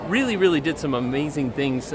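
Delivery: normal talking voice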